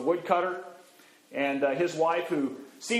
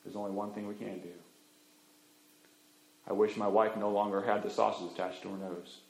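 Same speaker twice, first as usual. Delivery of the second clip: husband gently using the last wish for his wife; tender resignation; 10 semitones lower, 7 dB quieter than usual. Acted. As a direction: lower and much softer, gentle.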